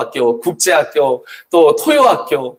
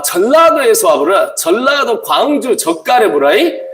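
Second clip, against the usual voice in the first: mock Jeolla dialect; 3 semitones higher than usual. Preacher playing a Jeolla local for the congregation, mock Jeolla accent. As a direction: play a Jeolla local for laughs.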